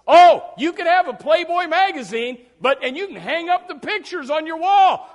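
loud, high scornful warning; mocking shout. Preacher mocking coworkers forbidding the Bible. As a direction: bark it like a sneering rule enforcer.

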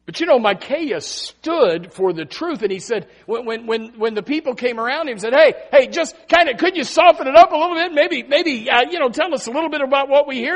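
loud, high, rushing sermon; the people begging Micaiah to soften it, in a preaching roar; preaching at full force. Acted. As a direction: build the crowd's plea to a peak.

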